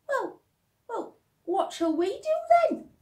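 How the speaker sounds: put-on a cheeky girl asking; child's voice. Laura asking mother.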